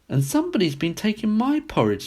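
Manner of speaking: a gentle hint of a mother bear. A narrator's reading. Mother bear finds her porridge touched, lightly voiced. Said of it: "said the mother bear in her middle-sized voice".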